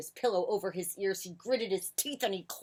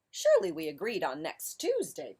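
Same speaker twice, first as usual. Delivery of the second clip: raised-pitch, louder protest; 5 semitones higher, 4 dB louder than usual. A narrator's reading. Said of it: "protested Bear".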